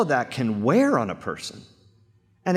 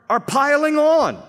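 preacher crying out the psalmist's despair; anguished cry; 10 semitones higher, 5 dB louder than usual. Acted. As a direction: pitch leaps higher and louder on the last words. Climax of psalmist's lament.

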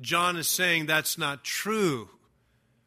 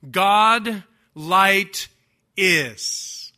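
"God" hit hard, "light" held, "is" set apart after a pause; Greek word order emphasis; 3 semitones higher, 7 dB louder, much slower than usual. Shown as a demonstration. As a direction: stress on "God", louder and drawn out, then a pause before "is". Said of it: "for emphasis, it reads".

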